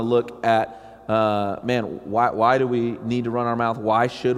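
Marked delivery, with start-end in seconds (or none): none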